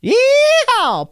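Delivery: high whooping cowboy yeehaw. Retold or imitated. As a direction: let out a big cowboy yeehaw. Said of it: "I was waiting for the yeehaw".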